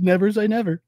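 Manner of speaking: smirking coy tease; coy Trump. Retold or imitated. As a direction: toss it off with a smirk. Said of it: "He says".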